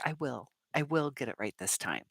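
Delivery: softly, firmly, with resolve; quiet firm affirmation. Shown as a demonstration. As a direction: say it quietly like a promise to yourself. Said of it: "I want you to say".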